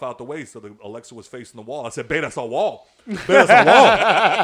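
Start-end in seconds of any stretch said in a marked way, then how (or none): 3.1-4.4 high, loud, slow through laughter; giggling excited realization; laughing repeat. Retold or imitated. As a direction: crack up repeating it.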